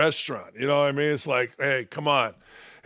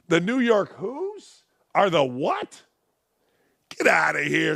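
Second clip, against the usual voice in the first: mock disbelief. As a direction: play dumb, drawn out. Mock confusion at Mets claim.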